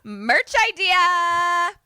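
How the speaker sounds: high, loud, drawn-out cry; gleeful announcement. Real, not acted. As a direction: sing out the brainwave, stretching the end. Gleeful announcement of merch idea.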